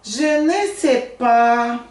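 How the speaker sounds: "Je ne sais pas" said slowly, syllable by syllable; slow model phrase. Shown as a demonstration. Teacher voices the friend answering, slowly. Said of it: "o amigo pega e fala".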